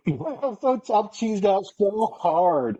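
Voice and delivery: high, drawn-out goofy laughing delivery; giddy grinning. Retold or imitated. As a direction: squeal it through a huge cheesy grin. Retells grinning helplessly in the photo.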